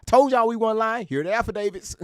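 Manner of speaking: high, sing-song mockery of liars; mimicking accusers waving papers, high and mocking; mocking impression. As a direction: whine it like a fake excuse.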